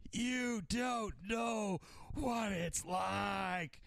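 a parody of soap-opera anguish, drawn out and yelled; hammed-up dramatic actor. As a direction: overact the line like a TV drama star. Bobby's big dramatic acting moment.

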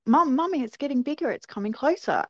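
put-on high-pitched, excited kid calling Mum; excited child calling. Young sisters telling Mum about the light, excited.

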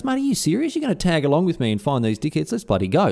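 can't believe his luck, asking if the mate's serious; disbelieving delight. Retold or imitated. Young Temujin thanks Borchu for joining the chase, amazed. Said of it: "Temujin can't believe it".

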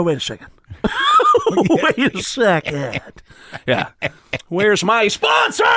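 exaggerated weird-cadence yell; that screamer's voice. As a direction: draw it out, then scream high. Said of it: "that voice, we go".

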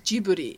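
Japanese-style Jiburi, with an R for the L; Japanese pronunciation. Shown as a demonstration. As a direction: say Jiburi the Japanese way, "jee-boo-ree". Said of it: "in Japanese it's pronounced".

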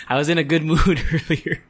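real laugh breaking up the line. Real, not acted. Laughs off her bad mood.

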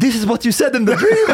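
high-pitched, cracking up; laughing protest. Real, not acted. Telling friends what they said in the dream, laughing hard. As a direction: insist through helpless laughter.

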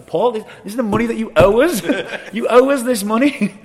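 high, loud protest with a laugh breaking in; re-enacted indignant outburst; exasperated retort. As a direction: raise the voice in disbelief at him. Retold exasperated retort over owed money.

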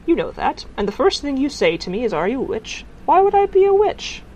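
high protest climbing to a loud finish; indignant protest. A narrator's reading. Woman denying to Tiffany she's a witch, indignant. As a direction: build to an incredulous question.

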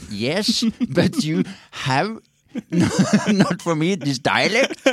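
high, halting English with laughter breaking in; film lover objecting. Acted. Lover answering in bed after the cigarette, mock reluctant.

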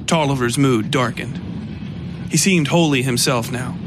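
ordinary delivery

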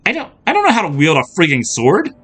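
high, rushed, panicky stammer; alarmed, incredulous outburst; flustered protest. A narrator's reading. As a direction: blurt it out in a fluster. Startled heroine handed a sword.

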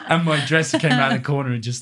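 mock scream. Dresser screaming story, retold.

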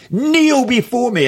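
high, slow regal command; commanding queen. Retold or imitated. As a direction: order them down like royalty.

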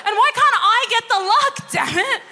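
high, loud indignant outcry with a laugh; indignant outburst. Retold or imitated. Answering the Swiss family, comic outrage. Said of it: "I was like".

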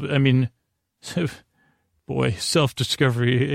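ordinary delivery

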